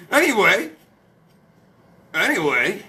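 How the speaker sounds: ordinary delivery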